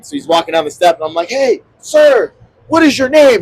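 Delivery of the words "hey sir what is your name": raised, high-pitched retold call; calling after someone. Tourist calls after departing candidate for his name, calling out. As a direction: call out to catch him leaving.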